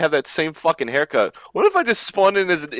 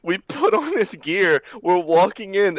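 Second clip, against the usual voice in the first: ordinary talk, nothing marked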